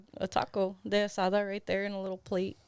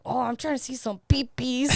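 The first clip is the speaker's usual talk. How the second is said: mock drunk voice; 5 semitones higher, 5 dB louder than usual. Retelling drunk claim in bar restroom.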